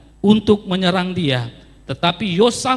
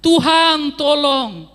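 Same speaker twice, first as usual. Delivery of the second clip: seruan minta tolong; 8 semitones higher, much slower than usual. Retold or imitated. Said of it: "Dia berteriak, berseru".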